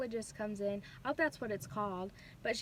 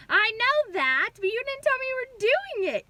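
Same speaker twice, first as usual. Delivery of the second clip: high, loud, exasperated retort; indignant protest; 12 semitones higher, 10 dB louder than usual. Retold or imitated. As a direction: snap back at her in high-pitched outrage. Retort to rude anesthesiologist.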